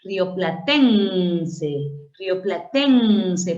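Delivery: Rioplatense very slowly, with a long heavy TEN; stress on TEN. Shown as a demonstration. Teacher showing where the accent moved, long stress on TEN in rioplatense. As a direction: stretch and lean on TEN in rioplatense. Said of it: "ya perdió su acento".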